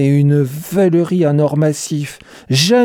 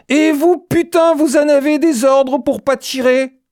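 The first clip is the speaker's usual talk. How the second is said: shrieking at the top of the voice, pitched way up; screamed; 11 semitones higher than usual. A narrator's reading. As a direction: scream it in his face. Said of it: "et hurla".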